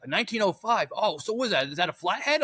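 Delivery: earnestly confused, up high; clueless guy voice. Retold or imitated. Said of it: "And he's like".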